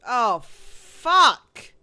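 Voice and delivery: loud, high outburst of dismay; real shout of alarm, voice jumping up; startled swearing. Real, not acted. Player caught out by the game, startled swearing.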